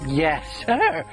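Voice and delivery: usual speaking voice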